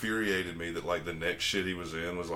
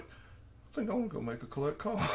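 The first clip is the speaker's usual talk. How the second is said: small, higher innocent voice; naive kid voice; 11 semitones higher, 6 dB quieter than usual. Retold or imitated. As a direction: play the eager young kid. Voicing young self.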